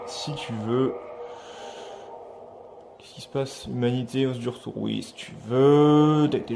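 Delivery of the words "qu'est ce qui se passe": whisper. Real, not acted. Unvoiced puzzled aside.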